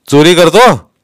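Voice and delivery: loud, high accusing question; police interrogator's challenge; accusing inspector. A narrator's reading. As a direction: throw the accusation at him. Inspector accusing the boy's father.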